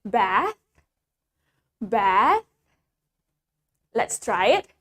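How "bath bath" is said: "bath" with a drawn-out A, rising; British long A. Shown as a demonstration. Teacher modelling British "bath" with long A. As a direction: hold the vowel of "bath". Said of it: "In British, we say it".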